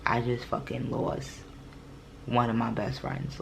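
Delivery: stunned realization. Retold or imitated. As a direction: let the loss sink in quietly. That's when it hit me.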